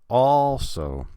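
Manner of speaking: "also" loud, high, first syllable held long; first-syllable stress. Shown as a demonstration. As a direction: stretch and punch the AL of "also". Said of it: "it's the first syllable".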